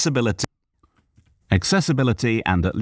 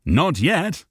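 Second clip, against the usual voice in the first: higher, upbeat reply; optimistic; 9 semitones higher than usual. A narrator's reading. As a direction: sound hopeful. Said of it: "Cal replied optimistically".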